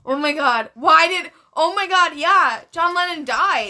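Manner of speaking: loud, high, shocked; oh my God, it dawns; shocked realization. Real, not acted. Realizes the mistake.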